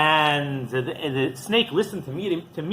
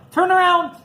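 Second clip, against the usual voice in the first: much higher, urgent shout to turn back; urgent command; 13 semitones higher, 4 dB louder than usual. Retold or imitated. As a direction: order the snake to turn around, high and loud. Rabbi orders the snake back.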